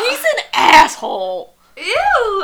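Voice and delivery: high, loud disgust, slow at first; disgusted outburst. Real, not acted. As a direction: spit out the disgust. Disgusted reaction to scene.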